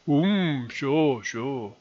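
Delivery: drawn-out hum, then falling doubtful sures; David Schwimmer from Friends. Acted. Vlogger mimicking a sitcom reaction gif, sceptical impression. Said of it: "David Schwimmer from Friends going".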